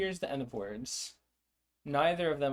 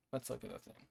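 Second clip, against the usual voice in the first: a quiet aside to yourself; muttered under breath; 11 dB quieter, faster than usual. Real, not acted. Talking herself into checking the notes, muttered softly. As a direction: repeat it much softer, to yourself.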